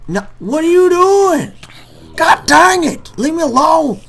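very high, very loud, slow drawn-out scream; furious screaming. Real, not acted. Gamer screaming at mobs to leave him alone, furious. Attacked again in game.

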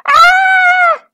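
high, loud scream of alarm; princess's scream. A narrator's reading. Little princess discovering a loose tooth, screaming. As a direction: scream it out at full pitch. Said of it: "screamed the little princess".

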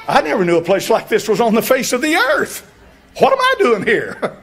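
high, loud cry of disbelief; shocked outcry. Retold or imitated. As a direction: shout it out, high and incredulous. Retelling his shocked boot-camp thought.

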